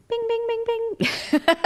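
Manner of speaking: string of very high dinging sounds; ping like a little bell; imitated alarm going off in the head; high-pitched bing noises. Imitates her excitement at the find.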